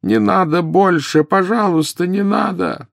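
high, slow tormented begging; howling plea. A narrator's reading. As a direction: wail it in agony. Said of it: "завопил". (вопль мольбы)

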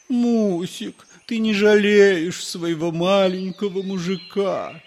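husband wheedling his wife for dinner; whining husband. A narrator's reading. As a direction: whine like a pitiful little man. Whiny husband begging for food.

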